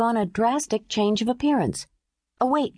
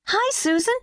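put-on high bright child greeting; cheerful little girl; 13 semitones higher than usual. A narrator's reading. Mimi bursts in and greets the babysitter, high and bright. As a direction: burst in chirping hello.

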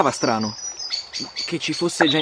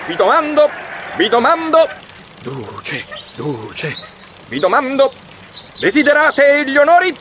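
high, loud fascist rally shouting; brassy old newsreel; 12 semitones higher, 5 dB louder, slower than usual. Acted. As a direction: bellow it to a crowd, newsreel style. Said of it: "tocca guardarsi anche il cinegiornale".

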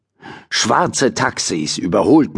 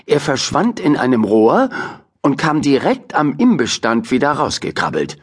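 ordinary delivery

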